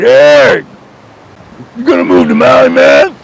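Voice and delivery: a high, hearty mock of the neighbor calling out; John's way of talking. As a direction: hail your neighbor like a laid-back smoker pal. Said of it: "That's how he talked".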